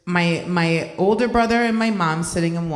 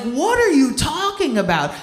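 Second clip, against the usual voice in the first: incredulous; 10 semitones higher, 4 dB louder than usual. Retold or imitated. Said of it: "I'm like".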